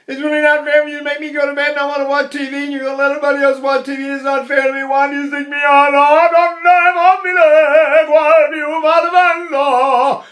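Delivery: put-on high, loud, whiny kid's complaint; whining child. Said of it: "So the whining child".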